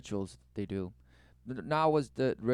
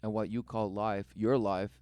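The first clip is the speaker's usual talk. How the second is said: hammed-up solemn preaching; mock sermon. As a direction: preach it like a grave prophet.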